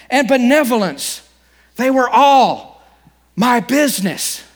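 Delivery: high, loud, slow ghostly lament; unearthly voice. Acted. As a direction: wail it like a tormented ghost. Ghost's lament, read with force.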